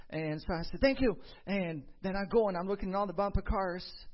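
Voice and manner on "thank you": excited thanks. Retold or imitated. Boy thanks ride attendant.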